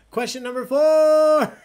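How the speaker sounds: loud, high, drawn-out announcement; announcer yell. Real, not acted. Host announcing the next question to players, big yell. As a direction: yell it, stretching the last word. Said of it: "Sorry, I'm yelling".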